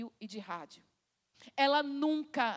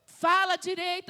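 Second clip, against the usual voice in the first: raised, high-pitched scolding order; exasperated parent; 5 semitones higher, 5 dB louder than usual. Acted. Parent to a crying child who can't be understood, exasperated. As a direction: cut through the child's wailing impatiently. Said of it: "aí você fala pra ela assim".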